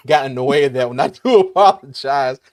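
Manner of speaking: laughing. Real, not acted. Laughing apology after mishearing.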